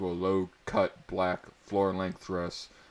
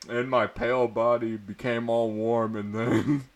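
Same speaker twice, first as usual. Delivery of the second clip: ordinary delivery, nothing marked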